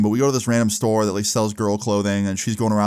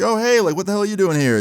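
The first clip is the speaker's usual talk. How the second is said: much higher, hammed-up innocent surprise; faked surprise; 9 semitones higher than usual. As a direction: play dumb, pretending you didn't see her. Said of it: "You know, acting surprised".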